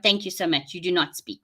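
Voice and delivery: ordinary delivery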